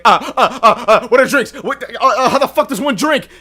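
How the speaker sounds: flustered fan panicking over a drink; nervous freakout. Retold or imitated. Re-enacting panicking in the store in front of the band, loud and laughing. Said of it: "I started freaking out in the fucking store".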